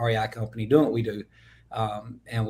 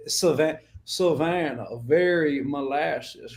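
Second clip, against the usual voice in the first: molasses-slow Savannah drawl; 2 semitones higher, slower than usual. Shown as a demonstration. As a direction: pour out a thick, slow Georgia accent. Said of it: "the stereotypical Georgia accent".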